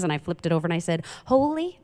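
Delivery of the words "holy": put-on higher child's answer, lifting at the end; third-grader. Third-grader answers her pastor dad, higher and rising. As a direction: answer like a little kid, pitch rising. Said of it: "third grade".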